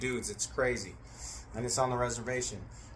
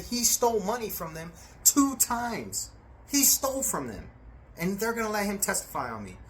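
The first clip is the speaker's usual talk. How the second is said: indignant protest; 7 semitones higher than usual. Retold or imitated. Angry protest retold to lawyer.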